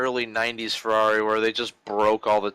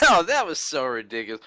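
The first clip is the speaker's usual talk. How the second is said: normal talking voice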